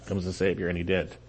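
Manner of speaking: hushed, reflective repeat; soft echo. Shown as a demonstration. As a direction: let the words sink in quietly. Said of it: "repeated softly".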